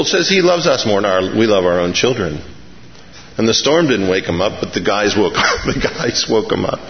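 normal talking voice

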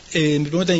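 ordinary talk

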